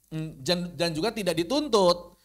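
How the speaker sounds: ordinary talk, nothing marked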